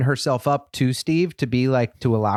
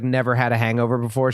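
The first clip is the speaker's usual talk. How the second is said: normal talking voice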